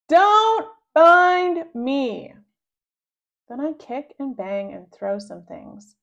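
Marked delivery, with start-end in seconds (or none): 0.1-2.3 high, slow, loud angry shout; one last furious shout. A narrator's reading. Boy shouting at brother who found him. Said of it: "I shout".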